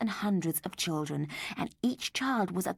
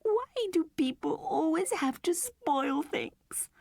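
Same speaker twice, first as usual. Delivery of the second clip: high, slow whispered sob; sobbing; 11 semitones higher, slower than usual. A narrator's reading. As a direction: choke the words out in tears. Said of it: "sobbed Mandy".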